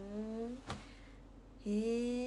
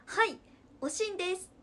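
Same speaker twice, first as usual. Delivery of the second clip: 子供の頃の自分を再現; 7 semitones higher, 6 dB louder than usual. Retold or imitated. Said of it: "みたいな".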